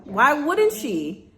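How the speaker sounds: ordinary talk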